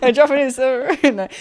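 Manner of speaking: words breaking into giggles; giggling while answering; laughing. Real, not acted. Laughing at a joke about a Japanese partner.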